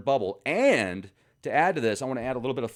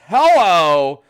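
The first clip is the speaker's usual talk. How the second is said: a loud high mocking "Hello!"; 6 semitones higher, 15 dB louder than usual. Real, not acted. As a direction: call out to wake everyone up. Mock wake-up call to viewers.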